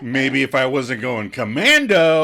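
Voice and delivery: hammed-up sing-out of a taunting quote; Chandler. Fan quoting Joey mocking Chandler in his clothes, hammed-up taunt. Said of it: "I'm Chandler".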